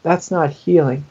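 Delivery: ordinary talk, nothing marked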